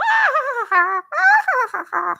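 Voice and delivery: a high, wild witch cackle; crazy cackle. Shown as a demonstration. Showing viewers how a crazy woman cackles. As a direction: cackle like a madwoman to scare people off. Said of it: "the way you have to cackle".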